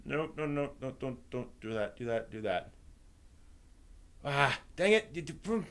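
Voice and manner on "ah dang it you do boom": normal talking voice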